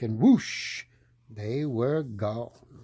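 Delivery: ordinary delivery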